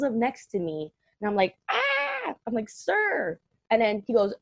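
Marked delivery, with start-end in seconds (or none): 1.7-2.3 short high-pitched retold scream; startled scream. Acted startled scream at pulling car. 2.7-3.4 high-pitched mock indignation; indignant address. Acted protest to the driver.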